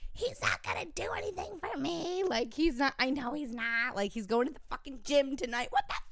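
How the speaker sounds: high complaining to the gals; high-pitched bitching voice messaging friends; whiny rant. Retold or imitated. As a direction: rant like a wronged girlfriend. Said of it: "And I'm like".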